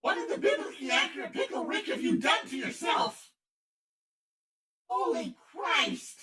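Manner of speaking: high, loud horrified yell; screaming in disbelief at a coffee bean; shocked shout. Real, not acted. Host yelling at his friend turned coffee bean, shocked shout.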